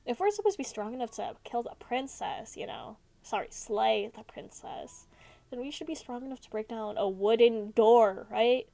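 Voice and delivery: ordinary talk, nothing marked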